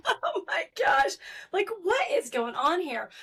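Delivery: high embarrassed laugh; giggling through the words. Real, not acted. Laughing embarrassed after coughing.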